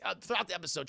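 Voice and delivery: normal talking voice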